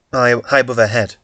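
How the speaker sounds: normal talking voice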